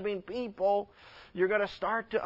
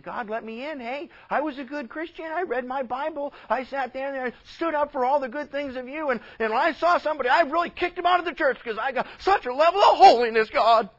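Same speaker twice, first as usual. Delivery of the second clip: higher, louder, rushing protest; self-righteous churchgoer; 6 semitones higher, 5 dB louder, faster than usual. Acted. Hypocrite protesting to God at the gate, indignant pleading.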